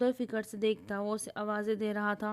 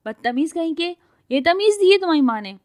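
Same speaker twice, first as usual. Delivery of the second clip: high, loud raging tirade; shouting at full pitch, losing it; furious shouting; 8 semitones higher, 11 dB louder than usual. Acted. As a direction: scream insults at him. She keeps screaming at him.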